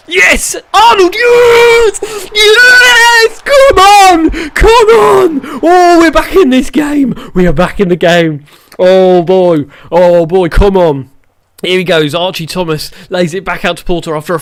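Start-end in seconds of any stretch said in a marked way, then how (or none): 0.1-5.4 screaming yes far higher and louder, almost crying; jubilant goal scream. Real, not acted. Manager celebrating his super sub's equaliser, ecstatic screaming. 5.6-11.1 breathless elation. Real, not acted. Manager relieved his team is back in the game, elated. After the equaliser.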